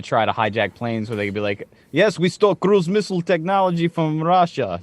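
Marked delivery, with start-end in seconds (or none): 1.9-4.8 put-on bragging terrorist in a jokey Russian accent; hammed-up Russian accent, a touch higher. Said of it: "they all speak with Russian accents".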